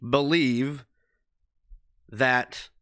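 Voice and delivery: ordinary talk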